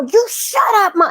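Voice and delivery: high mock toddler yell; a light take on the kid's shout; imitating toddler. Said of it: "he's saying it so loud".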